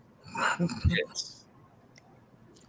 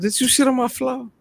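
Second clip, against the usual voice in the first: higher, louder accusing question at the dog; mock outrage; 7 dB louder than usual. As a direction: confront the dog in hammed-up disbelief. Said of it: "I said".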